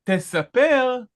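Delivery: Tesaper said high and stressed; Hebrew word said. Shown as a demonstration. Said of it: "we would have had you will count".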